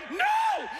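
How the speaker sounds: high panicked scream; high frantic no; shrieked no. Retold or imitated. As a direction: shriek it in terror. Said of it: "I go".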